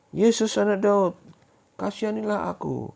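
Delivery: high, loud, slow pleading cry; blind beggar crying out. Acted. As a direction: call out begging for mercy. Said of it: "Dia berteriak-teriak".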